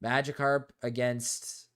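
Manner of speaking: ordinary talk, nothing marked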